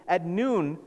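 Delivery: ordinary talk, nothing marked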